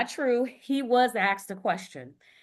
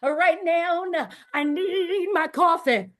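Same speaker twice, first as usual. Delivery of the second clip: higher, breathless pleading; playful higher pleading with a cry; whiny mock plea; 6 semitones higher than usual. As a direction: beg for coffee like a tired diva. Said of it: "I said".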